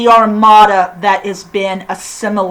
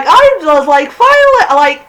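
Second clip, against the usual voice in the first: mock elated Picard; 8 semitones higher, 7 dB louder than usual. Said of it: "He's like".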